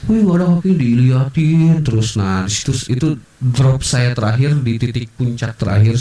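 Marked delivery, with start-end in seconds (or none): 0.1-0.7 high mimicked kid's call; higher childish exclamation; child calling friends. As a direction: imitate a kid calling the gang over. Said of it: "Manggil teman-temannya yang gerombolan anak kecil".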